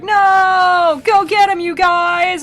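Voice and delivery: high, loud frantic shout; demons shouting. Retold or imitated. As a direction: yell at the other demons to chase him. Demons lose the escaping soul.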